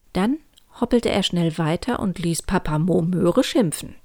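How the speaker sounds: plain reading voice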